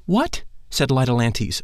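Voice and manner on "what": a sharp high astonished "What?". Acted. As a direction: jump up in pitch with surprise.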